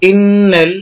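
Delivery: ইন্নাল with the doubled n held and hummed; obligatory ghunnah. Shown as a demonstration. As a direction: linger nasally on the n of ইন্নাল. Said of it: "গুন্না করতে হবে".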